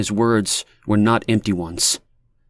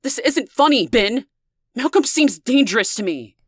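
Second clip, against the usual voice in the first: much higher, fearful pleading; frightened. A narrator's reading. Leah frightened by Ben's laughter on the line, shrill fear. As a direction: shaken and scared, pushing back. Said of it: "Her voice stumbled out of her, the fear".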